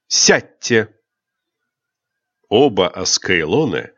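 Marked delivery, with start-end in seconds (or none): 0.1-0.9 sharp, louder order allowing no reply; whiplash command. A narrator's reading. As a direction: crack it like a whip. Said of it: "хлестнувший, нетерпящий возражений тон".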